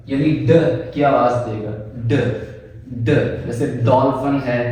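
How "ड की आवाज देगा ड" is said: crisp isolated retroflex "ड" sound. Shown as a demonstration. Teacher sounding out "ड" for the class. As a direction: say "ड" alone, clearly and short. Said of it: "ये आवाज हमारे पास देता है ड".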